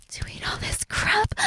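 soft, unvoiced whisper. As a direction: hush it under your breath. Whispered aside mid-scene.

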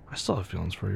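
soft tender confession. Acted. Confessing feelings after argument.

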